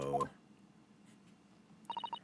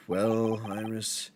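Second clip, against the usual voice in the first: lower, softer, slow posh-ish drone; teasing Sholmes by imitating his low slow voice; mock Sholmes; 6 semitones lower, 5 dB quieter, slower than usual. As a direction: lightly mimic the gloomy detective. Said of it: "Are you just like".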